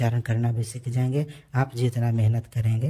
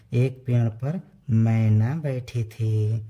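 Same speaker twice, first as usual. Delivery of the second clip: "मैना" said slowly and clearly for the class; slow model answer; slower than usual. Shown as a demonstration. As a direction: read the answer slowly, word by word. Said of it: "एक पेड़ पर क्या बैठी थी?". (धीरे-धीरे साफ़ उत्तर)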